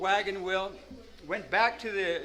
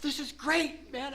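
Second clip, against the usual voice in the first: giddy excitement; 8 semitones higher than usual. Retold or imitated. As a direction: squeal with delight over a stray cat. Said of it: "I get giddy as a little girl".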